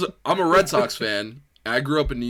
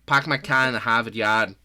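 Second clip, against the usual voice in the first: r-less Boston catchphrase delivery; Boston accent. Shown as a demonstration. Friends showing off the Boston accent, broad Boston accent. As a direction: drop every r, Boston style. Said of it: "Boston accent's totally different".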